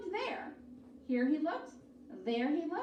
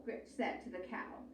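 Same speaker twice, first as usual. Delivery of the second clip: ordinary delivery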